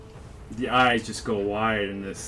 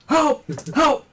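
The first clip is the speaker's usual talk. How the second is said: two loud, high yelps; screamed cry for help; 5 dB louder than usual. Acted. Cornered woman summoning the guards, screaming. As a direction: scream out sharply for rescue. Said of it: "she's just screaming".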